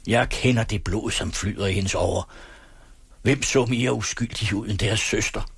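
usual speaking voice

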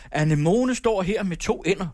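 irritated teacher. A narrator's reading. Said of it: "huggede hun blyanten i bordet og råbte".

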